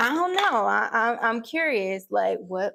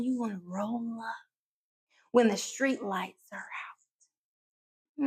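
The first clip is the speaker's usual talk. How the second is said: whisper; 6 dB quieter than usual. Retold or imitated. Whispered punchline about late visits.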